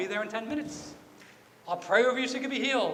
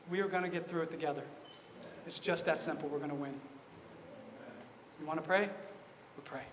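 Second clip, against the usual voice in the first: soft, low, comforting voice; quietly steady someone who is struggling; gentle reassurance; 5 semitones lower, 12 dB quieter than usual. Retold or imitated. Voicing reassurance to a struggling person.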